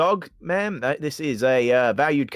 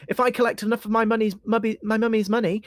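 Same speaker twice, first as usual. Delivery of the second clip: put-on higher childish stammering voice; a small shy skeleton child; 7 semitones higher than usual.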